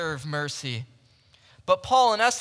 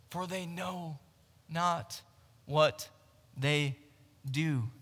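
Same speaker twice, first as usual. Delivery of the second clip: soft and halting, one word at a time; low-energy, broken-up delivery of a last prayer; dying words, imitated; 4 semitones lower, 7 dB quieter, slower than usual. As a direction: lightly act a dying man's plea, hushed, with long pauses. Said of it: "as he's gasping for air".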